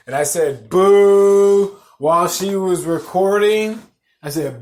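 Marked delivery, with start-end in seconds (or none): 0.7-1.8 loud, long sustained boo; drawn-out, booming jeer; heckler's boo. Retold or imitated. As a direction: boo the act from the crowd. Retells booing a comedy set.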